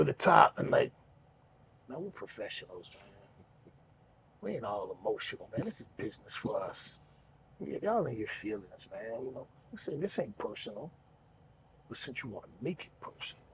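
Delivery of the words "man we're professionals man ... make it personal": much softer, lower menacing calm; calm low promo. Retold or imitated. Promo to rivals, calmly not raising his voice. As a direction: stay cool and drop the voice to pull them in. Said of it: "I'm keeping my voice low".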